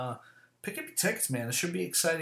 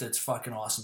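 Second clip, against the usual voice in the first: ordinary delivery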